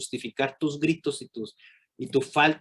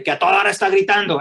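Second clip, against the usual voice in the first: rising shout; 7 semitones higher, 8 dB louder, faster than usual. Retold or imitated. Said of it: "discúlpenme lo que voy a hacer".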